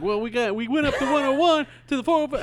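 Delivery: high, excited football commentator voice; hammed-up celebrity sportscaster; John Madden. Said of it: "he's just John Madden".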